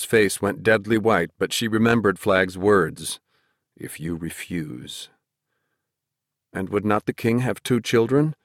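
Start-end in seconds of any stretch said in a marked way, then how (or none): 3.8-5.1 low, slow, hushed threat; sinister magician. A narrator's reading. Woman remembering Flag's words, in his sinister voice. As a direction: echo the threat softly and darkly.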